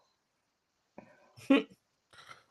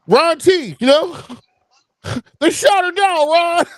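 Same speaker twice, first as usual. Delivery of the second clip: taunting; 12 semitones higher than usual. Real, not acted. Taunting Lyra fan.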